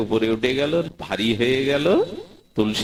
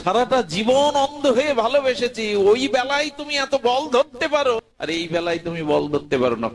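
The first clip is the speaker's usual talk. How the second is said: hurt reproach; 8 semitones higher than usual. Acted. Surdas reproaches Gopal, hurt.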